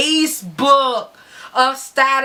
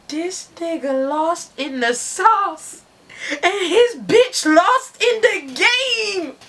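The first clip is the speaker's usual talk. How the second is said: dramatic; 6 semitones higher, slower than usual. Retold or imitated. Narrator's dramatic aside.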